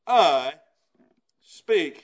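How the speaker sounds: "I" leaned on, then "speak" spaced out; stress on I. Acted. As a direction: land on "I speak" slowly. Preacher stresses scripture end.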